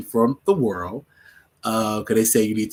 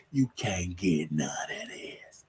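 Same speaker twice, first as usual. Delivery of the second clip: breathy whispered refusal, slow; 4 semitones lower, 8 dB quieter, much slower than usual. Retold or imitated. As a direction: drop to a secret whisper. Refusing sister, sudden hushed aside.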